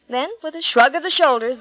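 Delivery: usual speaking voice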